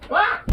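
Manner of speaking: sudden high yelp; startled yelp. Real, not acted. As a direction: yelp in surprise. Slips on the ladder.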